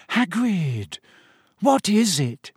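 high, stunned question; aghast. A narrator's reading. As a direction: ask in shocked alarm.

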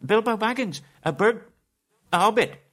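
flustered hobbit. Acted. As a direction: blurt it out in terror. Terrified Bilbo stammers.

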